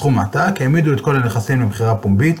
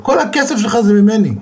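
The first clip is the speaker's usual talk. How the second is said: a touch of a hurt father's protest, higher; 10 semitones higher, 3 dB louder than usual. Retold or imitated. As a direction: protest in a raised pitch.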